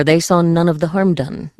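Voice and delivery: usual speaking voice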